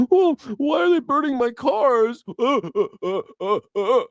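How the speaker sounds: hammed-up blubbering over burned cars, stuttering uh uh; mock crying Elon Musk. Mocking Elon Musk whining on TV about his burned cars, fake crying. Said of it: "on T V crying".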